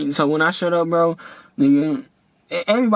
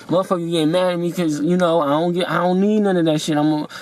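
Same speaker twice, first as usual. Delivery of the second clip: usual speaking voice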